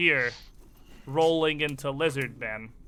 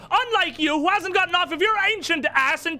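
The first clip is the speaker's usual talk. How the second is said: high-pitched, heated tirade; angry shouting; 12 semitones higher, 4 dB louder than usual. Acted. Woman berating an ancient do-nothing about the crypt problem, angry shouting. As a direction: rant at full tilt, pitched way up. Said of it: "hear the shouting now".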